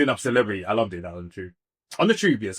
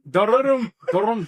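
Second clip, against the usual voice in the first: higher, louder West Country burr; Bristolian accent; 5 semitones higher, 4 dB louder than usual. Retold or imitated. Friends playing with place name, mock Bristolian accent. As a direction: put on a jokey Bristolian accent. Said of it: "You know the Bristolian accent?".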